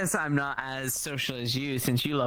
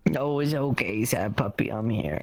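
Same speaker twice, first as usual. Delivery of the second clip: mock comforting; 7 semitones lower than usual. Wife cooing to her husband, the sad puppy. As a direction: soothe him like a sad puppy.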